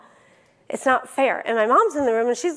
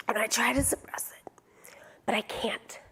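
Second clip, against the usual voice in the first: hushed, holding back a cough; 9 dB quieter than usual. Real, not acted. Trying to suppress a cough.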